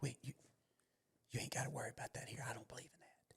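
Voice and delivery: fast hushed whisper. Retold or imitated. Teacher secretly reassuring the parent, fast whisper. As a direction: mimic a nervous teacher whispering the words. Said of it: "Why you gotta whisper that?".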